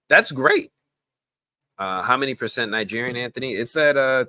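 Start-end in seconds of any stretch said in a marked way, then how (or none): 0.1-0.7 high, sarcastic that's great. Real, not acted. To the chatter, high sarcastic praise.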